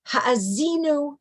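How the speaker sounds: slow "Ha'azinu", leaning on the ZI; marked stressed syllable. Shown as a demonstration. Teacher reciting verse for the class, slow stressed "Ha'azinu". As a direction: draw out the stressed beat in "Ha'azinu". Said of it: "Listen to the words".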